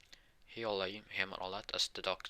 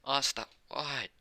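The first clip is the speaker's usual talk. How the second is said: higher, drawn-out mock lament; retold crying; 6 semitones higher, slower than usual. Noel hugging Asta's dead body, lightly acted crying. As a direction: imitate a grieving cry, slowly. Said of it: "crying, it's like".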